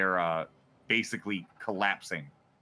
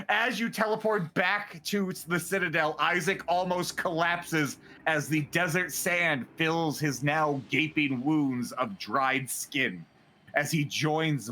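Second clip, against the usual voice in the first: dramatic scene setting, higher; game master narration; 4 semitones higher than usual. Acted. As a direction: narrate the collapse with weight.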